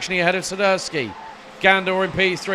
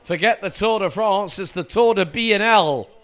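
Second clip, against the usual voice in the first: excited, raised race play-by-play; motorsport commentator; 3 semitones higher, 3 dB louder than usual. Acted. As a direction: call the race live and keen. Live race play-by-play, pre-final start.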